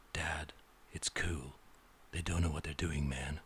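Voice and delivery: mock the modernized line as a hushed, chill bro; mock modern slang. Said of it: "we change it to".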